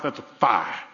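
American South pronunciation of "fire"; Southern accent. Shown as a demonstration. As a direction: say it Southern, "fire" flattened to one syllable "fah". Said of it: "as we say in the South".

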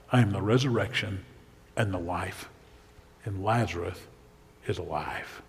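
low, soft, with pauses between phrases; hushed declaration. Acted. Preacher voices Jesus' claim to be the resurrection, hushed.

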